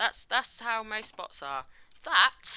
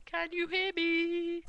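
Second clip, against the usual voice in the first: calling out; 9 semitones higher, 4 dB louder, slower than usual. Real, not acted. Checking if viewers can hear.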